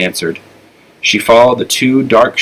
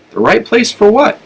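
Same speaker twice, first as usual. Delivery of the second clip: much higher, baffled question; genuinely confused; puzzled; 12 semitones higher than usual. A narrator's reading. Flora puzzled by Oscar's words, asking in baffled surprise.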